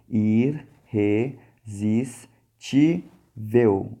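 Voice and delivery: "Irresistível" stretched very slowly, each syllable separate. Shown as a demonstration. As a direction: split "Irresistível" into syllables with clear pauses. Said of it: "vamos classificar ela".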